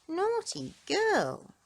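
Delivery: high, slow tut-tutting; mock scolding. A narrator's reading. Narrator to listening children, a slow mock-scolding tut. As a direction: wag a finger at her, slowly.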